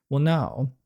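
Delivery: normal talking voice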